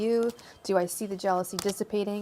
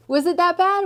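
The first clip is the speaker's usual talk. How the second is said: mock Australian accent; 10 semitones higher, 9 dB louder than usual. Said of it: "I try".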